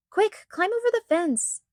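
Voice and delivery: put-on a high, wild yell to the other girls to climb the fence. A narrator's reading. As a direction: shout it in a panic as the dog escapes. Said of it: "yelled Agatha wildly".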